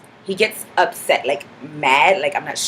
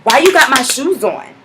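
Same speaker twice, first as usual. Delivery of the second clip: loud, higher, confrontational; aggressive retort; 6 semitones higher, 7 dB louder than usual. Retold or imitated. Girl at the bar confronting the narrator, aggressive. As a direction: get in her face over the shoes. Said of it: "like, really aggressively".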